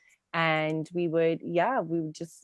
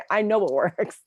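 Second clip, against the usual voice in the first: laughing; 3 semitones higher, 5 dB louder than usual. Real, not acted. Laughing while she speaks.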